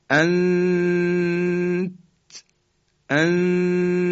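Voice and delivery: "انتم" with the hidden noon drawn out high before ta; ikhfa. Shown as a demonstration. Teacher shows how to wait at the ta, long ikhfa. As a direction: hold the hum in "انتم", then release the ta.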